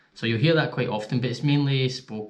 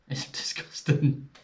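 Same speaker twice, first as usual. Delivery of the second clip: laughing; 4 semitones higher, slower than usual. Real, not acted.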